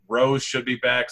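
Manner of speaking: usual speaking voice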